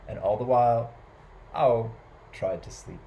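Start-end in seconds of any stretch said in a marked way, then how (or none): none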